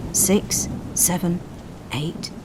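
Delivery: usual speaking voice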